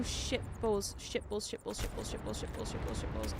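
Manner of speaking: low, soft repeated cursing, fading down; muttered cursing. Real, not acted. Stunned in the game, she mutters it to herself. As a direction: grumble it under the breath.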